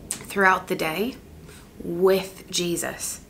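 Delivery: soft guided breathing exercise. Shown as a demonstration. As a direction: lead the breath calmly and gently. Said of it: "Let's do it right now".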